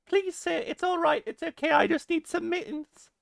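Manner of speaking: very high, squeaky put-on British boy; exaggerated high-pitched little British lad. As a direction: plead like a tiny British child. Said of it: "like a little British boy".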